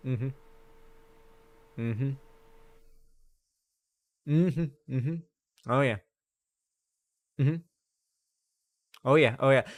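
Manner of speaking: a hint of a stoic soldier half-listening in a jeep; disinterested mm-hmms. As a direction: hum along like a bored soldier not listening. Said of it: "just going".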